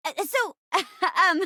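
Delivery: usual speaking voice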